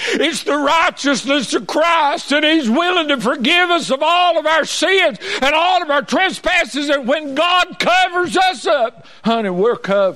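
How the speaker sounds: high, impassioned revival preaching; full-force preaching. Real, not acted. Preacher on Christ covering our sins, full-force preaching. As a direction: drive it high and hard from the pulpit.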